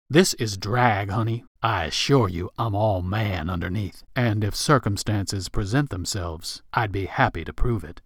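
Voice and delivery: low, smooth drag queen assurance; sultry drag queen. Acted. Marilyn assures Rick she's all man underneath, low and flirty.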